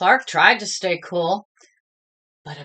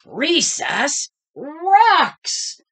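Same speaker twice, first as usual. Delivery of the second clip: loud, slow, drawn-out bellow; 7 semitones higher, 6 dB louder, much slower than usual. A narrator's reading. Clark bellowing about recess, loud and slow. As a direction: bellow it across the playground. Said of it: "bellowed Clark the shark".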